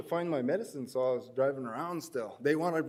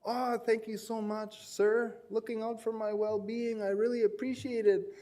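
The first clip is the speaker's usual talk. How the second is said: over-apologetic thanks; 6 semitones higher than usual. Retold or imitated. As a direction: lay the gratitude on thick, a bit higher. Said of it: "I just was over apologetic to him".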